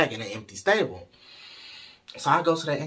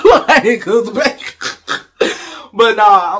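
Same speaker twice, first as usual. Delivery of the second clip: real laughter breaking up the words; high, loud, cracking up at your own joke; laughing; 10 semitones higher, 15 dB louder, much slower than usual. Real, not acted. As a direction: talk through a burst of laughter.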